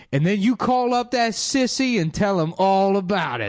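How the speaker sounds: high, mocking old-school comic voice; punchline voice. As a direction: deliver it like a stand-up punchline. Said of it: "the big punchline at the end is".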